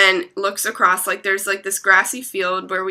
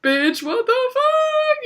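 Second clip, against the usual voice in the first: retold stunned shock; slower than usual. Storyteller acting out freezing at a ghost, stunned shock. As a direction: stretch it out in high-pitched mock shock. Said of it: "just, like, standing there, like, frozen".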